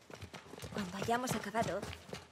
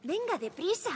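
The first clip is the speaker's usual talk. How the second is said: urgent call; 10 semitones higher, 7 dB louder than usual. Acted. Urging friend to hurry.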